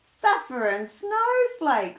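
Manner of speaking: loud, slow astonished cry; astonished exclamation. Acted. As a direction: gasp it out in amazement. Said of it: "says Santa".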